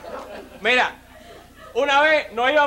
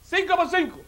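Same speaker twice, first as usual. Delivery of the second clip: high, announced quiz question; executioner quizzing; 7 semitones higher than usual. Acted. Executioner tests the condemned man with a sum, called out high. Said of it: "Le voy a hacer una cuenta".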